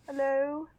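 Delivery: high-pitched, halting British accent; British accent attempt. Shown as a demonstration. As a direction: put on a careful amateur British voice. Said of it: "What accents do you do successfully?".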